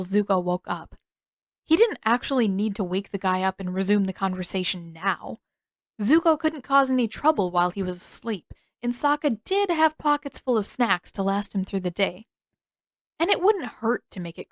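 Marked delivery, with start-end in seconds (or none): none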